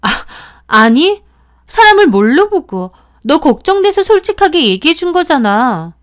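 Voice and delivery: high, loud indignant cry; shrill-free but high, loud indignation; angry outburst. Acted. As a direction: blow up, offended. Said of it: "소희는 화까지 내더라고요".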